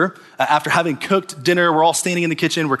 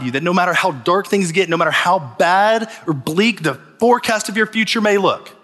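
preaching at full force; 6 semitones higher than usual. Acted. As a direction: build it up and lift the church.

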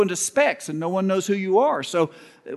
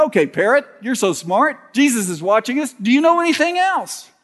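mocking the parrot; 6 semitones higher, 3 dB louder than usual. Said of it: "And they go".

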